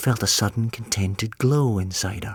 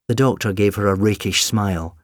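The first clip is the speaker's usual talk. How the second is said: usual speaking voice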